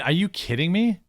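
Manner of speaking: usual speaking voice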